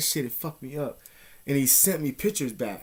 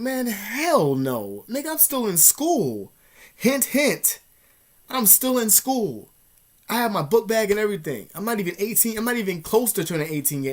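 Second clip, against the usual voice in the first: indignant refusal; 7 semitones higher, 4 dB louder than usual. Retold or imitated. Minor refusing an older man's demand, indignant protest. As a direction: replay an incredulous refusal, stretching the key words.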